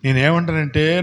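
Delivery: normal talking voice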